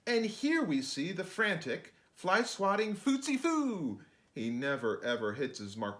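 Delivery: put-on lively sing-song read-aloud for kids; bouncy picture-book narration; rhyming storybook reading. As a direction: read it like a bedtime rhyme to children.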